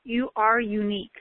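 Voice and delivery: ordinary talk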